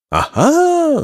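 very high "aha"; soaring storybook exclamation; exclaiming count. A narrator's reading. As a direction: cry out as if greatly impressed. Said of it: "zakrzyknął hrabia".

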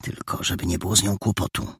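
soft, fast whisper; quick hushed aside. Acted. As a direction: mutter a worry under the breath. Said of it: "wyszeptał Perełka".

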